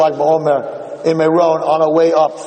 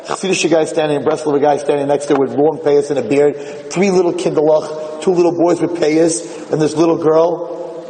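ordinary talk

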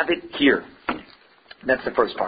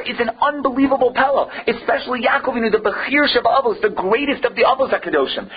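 fervent preaching; 10 semitones higher than usual. Acted. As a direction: hammer home the disbelief to the class. Impassioned rhetorical preaching.

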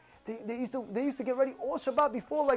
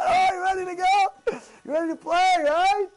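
loud, high trash-talk shout; acted competitive fire; pumped up; 6 semitones higher, 4 dB louder than usual. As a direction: hype yourself up before a game. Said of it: "You excited?".

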